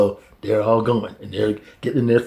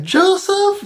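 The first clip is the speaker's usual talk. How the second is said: very high wondering name; awed recognition. Retold or imitated. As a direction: say his name in awe. Brothers realize.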